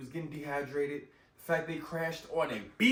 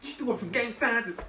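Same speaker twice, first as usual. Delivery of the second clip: ordinary talk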